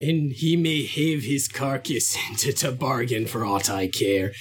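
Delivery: hammed-up Yorkshire accent, near a pirate growl; pirate-ish Yorkshire accent. Quiz player reads Joseph's lines for points, bad Yorkshire accent. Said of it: "more of a pirate thing".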